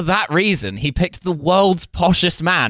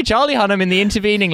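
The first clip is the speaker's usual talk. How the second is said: ordinary talk, nothing marked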